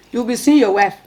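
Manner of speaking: ordinary talk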